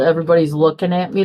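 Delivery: normal talking voice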